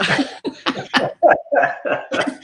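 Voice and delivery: hearty breathy laughter; real laugh. Real, not acted. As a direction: laugh out loud at yourself. Laughs at her own crying.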